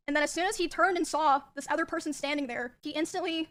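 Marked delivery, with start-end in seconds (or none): none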